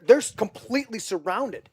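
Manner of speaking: ordinary talk, nothing marked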